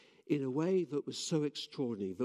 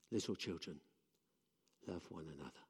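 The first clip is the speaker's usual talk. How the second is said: much softer and lower, tender; hushed, tender; 7 semitones lower, 9 dB quieter, faster than usual. Acted. Preacher sums up the heart of the message, hushed. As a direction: hold it close like a secret.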